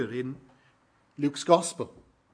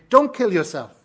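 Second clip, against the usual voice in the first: loud urgent call across the prison; 5 semitones higher, 8 dB louder than usual. Retold or imitated. Paul calls out to the jailer about to kill himself, loud urgent call. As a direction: shout it to stop him in time. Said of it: "And Paul calls out to him".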